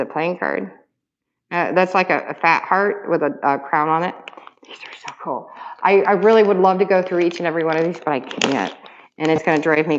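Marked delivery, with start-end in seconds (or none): 4.7-5.5 hushed whisper of delight; awed whisper. Real, not acted. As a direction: whisper it, voiceless and soft. Whispering in awe.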